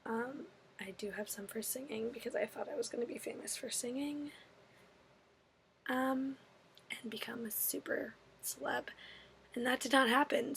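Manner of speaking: hushed, embarrassed whisper. Real, not acted. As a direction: whisper it like a confession. Self-mocking aside about fame dreams.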